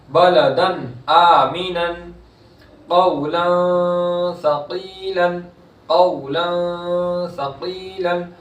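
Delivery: slow, drawn-out Quranic chanting; tajweed recitation. Shown as a demonstration. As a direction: stretch the vowels in recitation. Recites example.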